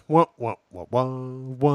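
wah-wah trombone, last note drawn out; vocal sad trombone, slowing to a long final wah; sad trombone imitation. Co-host teasing a nervous partner, mock sad trombone.

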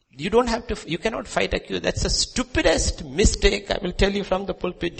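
high, loud pulpit cry; rising sermon shout; fiery preaching. Real, not acted. Preaching at full force, high and loud.

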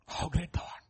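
breath-only reverent whisper; whispered awe. Shown as a demonstration. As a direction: whisper it in wonder. Hymn line repeated, whispered.

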